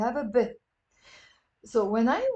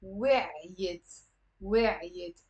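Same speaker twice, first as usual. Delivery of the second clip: lower-pitched, deliberate وعيت in two parts; two syllables; 4 semitones lower than usual. Shown as a demonstration. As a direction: separate the two beats of وعيت. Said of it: "It's two syllables".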